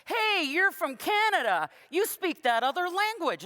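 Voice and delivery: called out high and loud from the back of the room; a stranger hailing her from the back of the room, booming; big booming voice. Retold or imitated. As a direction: boom it across the room at the newcomer. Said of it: "a big booming voice from the back of the room".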